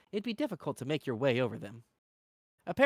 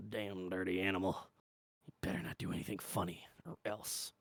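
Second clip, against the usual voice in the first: low, quiet threat; menacing guard; 6 semitones lower, 9 dB quieter than usual. Acted. Guard threatening travelers at the gate, low and menacing. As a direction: warn them under your breath.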